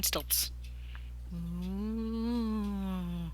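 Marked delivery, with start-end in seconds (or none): 1.3-3.3 spooky ghost moan. Acted. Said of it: "Spooky ghost voice".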